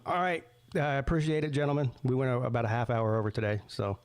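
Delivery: normal talking voice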